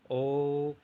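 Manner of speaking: slow drawn-out "ओ" for the class; prolonged vowel. Shown as a demonstration. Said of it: "उनको प्लुप्त स्वर कहते हैं।".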